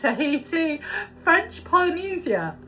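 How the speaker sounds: high, giggly voice; words through leftover laughter; laughing still. Real, not acted. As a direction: still giggling after the stumble. Still laughing after stumbling.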